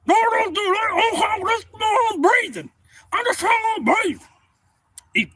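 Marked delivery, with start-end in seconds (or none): 0.1-4.2 high frantic yell; panicked shout. Retold or imitated. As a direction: yell in alarm. Stuck man protesting.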